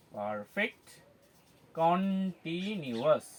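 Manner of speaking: পারফেক্ট কন্টিনিউয়াস stretched slowly, broken as পার-ফেক্ট, কন-টি-নিউয়াস; slow syllables. Shown as a demonstration. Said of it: "একইভাবে আমরা উচ্চারণ করব". (পারফেক্ট কন্টিনিউয়াস, ধীরে syllable টেনে টেনে)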